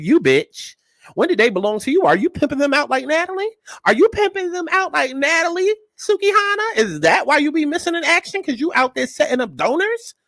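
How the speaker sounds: rising outrage. Real, not acted. Host calling out [name], rising indignant pitch.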